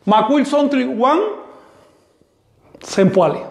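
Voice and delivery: "Macuil Sontli Juan Sempoale" slowed, "Juan" rising; slow model. Shown as a demonstration. Teacher shows students how the linker sounds. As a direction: join the parts with "Juan", slow and clear. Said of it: "el ligativo Juan".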